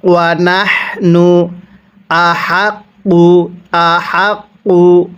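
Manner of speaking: the হা of নাহনু and আহাক্কু with light air from the throat; breathy ha. Shown as a demonstration. Teacher shows the breathy ha sound, slow recitation. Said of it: "কিভাবে পড়ব?".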